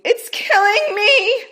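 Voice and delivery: high, loud, slow wail; crying out in agony; pained cry. Acted. Rachel crying out in labor pain.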